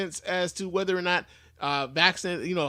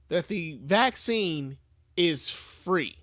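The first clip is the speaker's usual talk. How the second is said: slow emphatic; much slower than usual. Real, not acted. Emphasizing free vaccine to viewers.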